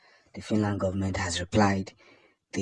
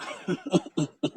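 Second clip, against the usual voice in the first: high laugh; 10 semitones higher than usual. Real, not acted. Laughs after the gospel boast.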